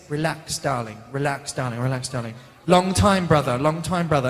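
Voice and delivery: put-on lightly done English accent, coached line by line. Joseph coaching him in an English accent, lightly done. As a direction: say it the English way, rehearsing each line. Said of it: "Joseph, how do I say this?".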